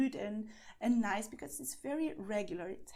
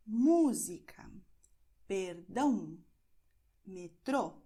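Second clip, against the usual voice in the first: música, perdão, metrô, each accented syllable punched louder; stress on accented syllable; 2 semitones lower, 7 dB louder, slower than usual. Shown as a demonstration. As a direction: hit MÚ in música, DÃO in perdão, TRÔ in metrô. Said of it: "you're going to stress this syllable".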